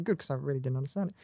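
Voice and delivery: soft, quick, fading away; trailing off. Retold or imitated. Fan showing how the Doctor's line lands, trailing off. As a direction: let the line tail off quietly. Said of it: "it's the way that line trails off".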